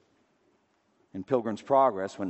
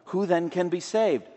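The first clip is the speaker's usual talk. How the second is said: raised, higher cry of dismay; anguished cry; 9 semitones higher, 6 dB louder than usual. Retold or imitated. A listener crying out to the preacher, a raised, higher cry of dismay. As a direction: let it burst out as a desperate question. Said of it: "someone cries out".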